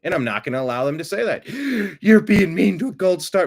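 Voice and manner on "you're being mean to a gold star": mock offended gasp. Mocking offended critic.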